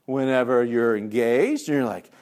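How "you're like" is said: normal talking voice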